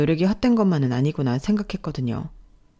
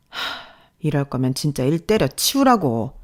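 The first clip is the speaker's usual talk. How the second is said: heavy sigh, then slow, weary rebuke; exasperated sigh; 2 semitones lower than usual. Acted. Boss to struggling employee, fed up. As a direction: sigh out your exasperation before scolding.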